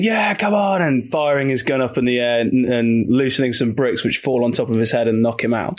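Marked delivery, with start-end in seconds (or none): 0.0-0.8 higher-pitched imitation of a battle cry; half-hearted mock scream, pitched up; mock battle yell. Retelling the hero charging into the film's fight, mock yell.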